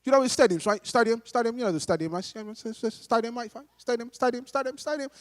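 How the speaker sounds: restless, repetitive Cockney geezer; Cockney accent. Acted. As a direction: pester like a jittery Cockney. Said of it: "I put on the same accent".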